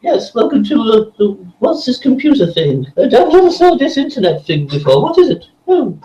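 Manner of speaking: high, quavering elder full of oohs; doddery old person. Acted. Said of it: "that's my favourite one".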